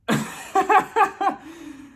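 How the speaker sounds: loud, high burst of laughter. Real, not acted. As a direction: crack up laughing. Cracks up after reading the email.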